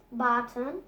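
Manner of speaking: plain reading voice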